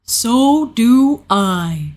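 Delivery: very slow, drawn-out bear voice; Pooh Bear. A narrator's reading. Pooh answers Christopher Robin, very slow and fond. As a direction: draw each word out contentedly. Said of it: "said Pooh".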